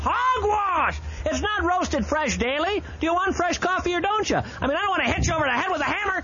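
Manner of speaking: high, ranting fake fury; mock outrage. Host mock-ranting at listeners about grocery coffee, high. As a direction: rail at the listener in hammed-up outrage.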